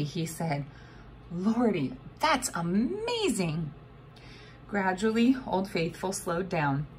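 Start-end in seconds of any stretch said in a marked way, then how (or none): 1.3-3.7 slow, awestruck exclamation; amazed stranger. A narrator's reading. Open-mouthed man at the geyser, slow and awed. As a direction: let the wonder stretch out.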